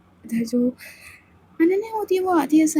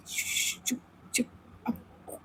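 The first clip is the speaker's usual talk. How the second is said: breathless hushed whisper; urgent whisper; 14 dB quieter than usual. Acted. Samaira hushing Aditi before opening the door, whispered. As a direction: shush her fast.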